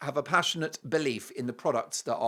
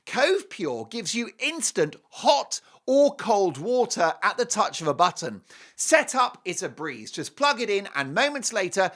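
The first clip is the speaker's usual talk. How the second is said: ordinary talk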